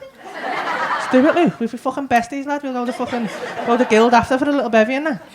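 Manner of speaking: usual speaking voice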